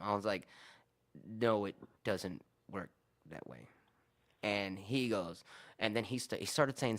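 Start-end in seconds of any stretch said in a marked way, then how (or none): 1.2-3.7 slow and deliberate, trailing down low; slow, spaced-out no, dropping away at the end; slow, exasperated refusal. Retold or imitated. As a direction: spell it out word by word, losing patience.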